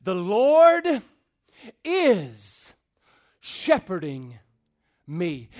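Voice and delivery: "The Lord" loud, then "is", "shepherding", "me" each set apart; word-by-word emphasis. Shown as a demonstration. Preacher driving home the verb, word-by-word emphasis. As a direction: pause after "Lord" and after "is", stepping down to "me". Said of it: "puts the emphasis on what the shepherd is doing".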